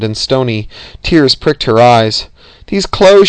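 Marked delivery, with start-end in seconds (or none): 2.7-3.3 high, louder desperate plea; urgent, desperate insistence. A narrator's reading. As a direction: raise the pitch and push it out desperately. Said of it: "she said desperately".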